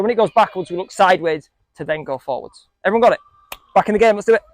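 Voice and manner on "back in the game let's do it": ordinary delivery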